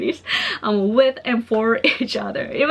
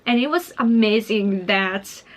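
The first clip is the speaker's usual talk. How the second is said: normal talking voice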